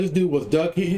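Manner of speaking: ordinary delivery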